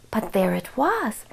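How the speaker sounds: usual speaking voice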